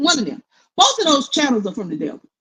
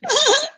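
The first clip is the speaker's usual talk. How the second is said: high laugh. Real, not acted. Laughing at own joke.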